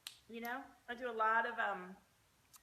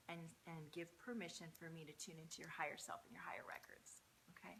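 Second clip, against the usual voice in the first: soft whispered request; 3 semitones lower, 11 dB quieter than usual. Real, not acted. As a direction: breathe the words without voice. Asks for permission softly.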